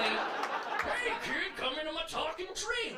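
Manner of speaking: high, sing-song creepy host; creepy kids' show host. Acted. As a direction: lure the kid in with fake sweetness. Creepy TV character voiced.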